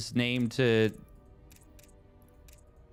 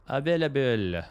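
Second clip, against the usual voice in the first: mock French accent; 3 semitones higher than usual. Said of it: "Some French accent".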